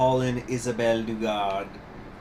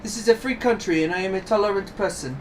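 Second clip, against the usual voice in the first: high-pitched, composed lady's voice; composed accused madame; 10 semitones higher, 6 dB louder, faster than usual. Acted. Brothel keeper defending herself before the judge, high composed voice. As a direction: defend yourself in a high, proper voice.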